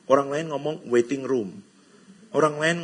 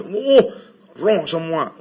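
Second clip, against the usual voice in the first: mock exclaim; 6 dB louder, much slower than usual. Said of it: "jadi jangan terus".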